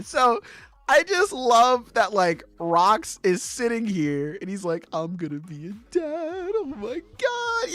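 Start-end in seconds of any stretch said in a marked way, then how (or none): 4.9-7.7 mock weepy, voice leaping high; hammed-up happy crying; tearful joy. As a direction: blubber happily, softly, rising into a squeak. Acts out overjoyed tearful father-to-be.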